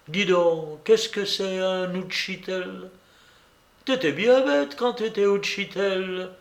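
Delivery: a hint of a teasing young woman; Blanche.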